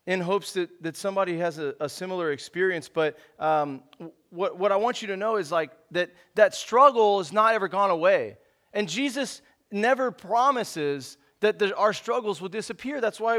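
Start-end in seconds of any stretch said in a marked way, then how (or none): none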